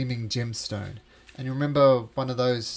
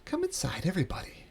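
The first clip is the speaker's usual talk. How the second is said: welcoming unseen voice. Acted. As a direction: coax them in with a lilting host voice. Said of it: "you hear a voice and says".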